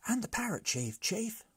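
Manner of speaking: brigands' voice. A narrator's reading. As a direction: chime in, high. Brigands adding.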